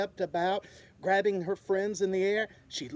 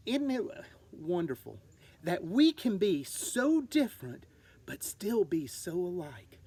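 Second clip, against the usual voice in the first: plain reading voice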